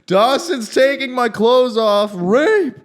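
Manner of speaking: very high, loud mock scream; retold drunken screaming. Student reenacting his drunken screams at helpful friend, mock screaming. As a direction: scream it out in mock alarm, drawn out. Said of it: "then I started screaming".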